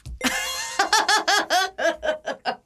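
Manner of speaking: high burst of laughter; loud laugh. Real, not acted. Laughs at guess.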